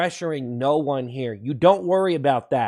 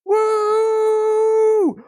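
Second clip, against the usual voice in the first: long, high woo; drawn-out high hype whoop; high whooping cheer; 5 dB louder than usual. Real, not acted. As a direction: whoop it up for the stream. Hyping up the stream with whoops.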